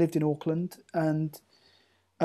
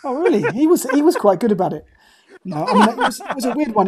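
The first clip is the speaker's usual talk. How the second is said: talking through real laughter, higher and louder; laughing; 8 semitones higher, 9 dB louder than usual. Real, not acted. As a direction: laugh it off while answering. Reacts to accent called ropey.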